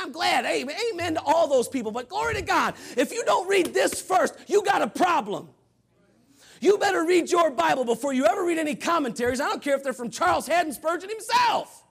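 loud, urgent preaching; preaching at full force. Real, not acted. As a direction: press the warning hard on the congregation.

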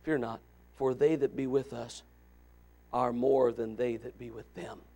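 unhurried, gentle prophet; calm, not yelled. Acted. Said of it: "I believe that's how he said it".

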